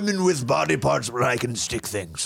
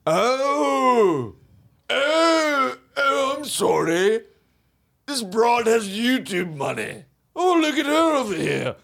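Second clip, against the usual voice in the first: drawn-out, affected mock amazement; exaggerated haughty oohing; mocking rich snob; 7 semitones higher, slower than usual. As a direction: sneer at the little people's money like a pompous millionaire.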